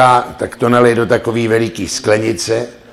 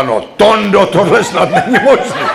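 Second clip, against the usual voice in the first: higher, louder head-shaking wife voice; exasperated wife; 8 semitones higher, 5 dB louder than usual. Acted. Wife in the doorway scolding Tonda, high exasperated voice.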